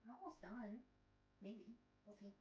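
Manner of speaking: very soft murmur under the breath; hushed aside. Real, not acted. Owner softly telling the dog to wait a bit. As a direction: mumble it off-mic to the dog.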